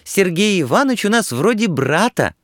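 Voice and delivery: put-on very high-pitched, artless girlish squeal; naive squeal. A narrator's reading. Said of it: "наивно взвизгнула Нюра".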